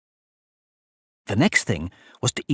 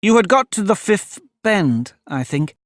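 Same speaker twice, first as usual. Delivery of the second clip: higher-pitched, tentative reply; very humble; 8 semitones higher than usual. A narrator's reading. Alice answers the cross mouse, humbly. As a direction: offer it meekly, tailing down at the end. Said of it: "said Alice very humbly".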